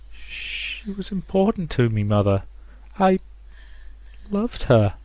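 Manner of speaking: acted hurt, high and soft at first; halting, broken-up protest to mother; pained. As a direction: plead through the pain. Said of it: "Rosa screamed out her pain".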